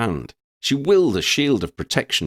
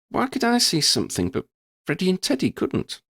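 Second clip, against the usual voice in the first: put-on much higher young voice, wondering; Lydia asking; 11 semitones higher than usual. A narrator's reading. Lydia asks Verdi about the monster, wondering. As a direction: ask in wide-eyed wonder.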